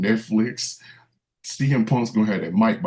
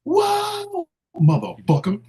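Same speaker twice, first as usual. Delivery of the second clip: wrestling promo; much slower than usual. Retold or imitated.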